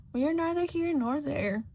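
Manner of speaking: high, sly cat voice; Cheshire Cat voice. Acted. The floating cat teasing Shota, high and sly. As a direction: tease like a grinning cat. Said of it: "the cat disagrees".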